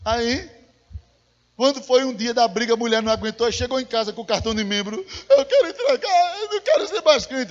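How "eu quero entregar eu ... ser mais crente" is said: high, loud hammed-up crying; mock wailing. Preacher imitating a church member handing back her card, mock tearful wail. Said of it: "ela tava chorando lá dentro".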